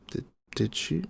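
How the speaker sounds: ordinary talk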